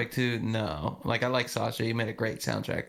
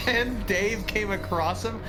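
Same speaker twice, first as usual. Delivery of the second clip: ordinary talk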